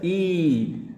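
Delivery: a stretched, high "ई"; long vowel demonstration. Shown as a demonstration. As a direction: draw out "ई" for learners. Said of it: "उसका उच्चारण क्या होता है?".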